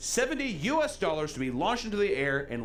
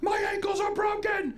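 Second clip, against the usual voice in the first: very high mock scream of pain; screaming injured skier. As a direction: wail it like a hammy movie injury. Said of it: "he's like".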